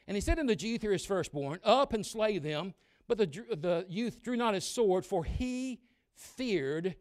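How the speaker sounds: slow, weighty reading of the verse; measured scripture voice; solemn scripture reading. Acted. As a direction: read it like holy writ. Scripture read in sermon.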